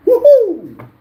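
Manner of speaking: high, loud woohoo; whooping cheer. Real, not acted. Host after reading a chat message, whooping cheer. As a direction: let out a big high whoop.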